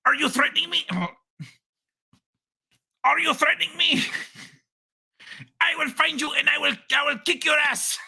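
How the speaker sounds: high, shouted mock threat; mocking the tough guy. Streamer retells the chat guy's threat, high mock shouting. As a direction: puff up like a keyboard warrior. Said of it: "The guy's response".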